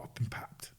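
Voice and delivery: unvoiced, breathless aside; stunned whisper. Coach realises the paparazzi snapped him, whispering in shock. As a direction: whisper it in disbelief.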